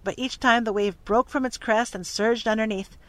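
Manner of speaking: usual speaking voice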